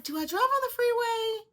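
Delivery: 1 anxious self-question. Retold or imitated. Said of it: "and I'm like".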